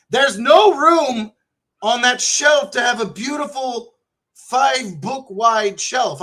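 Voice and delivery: high, drawn-out exasperated shout; mock pissed off. Griping to Brian about shelf space, mock angry. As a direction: complain loudly, stretching out the words in mock outrage. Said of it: "I'm, I'm a little pissed".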